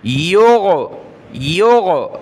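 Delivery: योगो with a throaty gh in place of g; योगो, middle consonant as Arabic ghain; ghain sound. Shown as a demonstration. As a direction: bring the g of योगो from the back of the throat. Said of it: "गैन निकाले".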